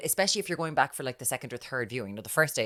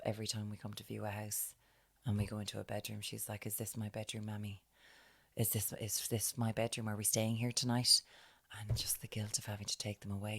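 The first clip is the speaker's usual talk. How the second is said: soft, hushed sympathetic retelling; 4 semitones lower, 10 dB quieter than usual. Retold or imitated. To her friend about the struggling mother, hushed and soft. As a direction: murmur it low as if sharing sad news.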